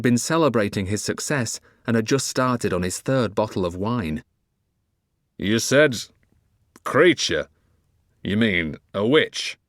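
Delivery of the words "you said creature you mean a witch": slow, slurred, frowning question; drunken slur. Acted. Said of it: "he slurred, frowning".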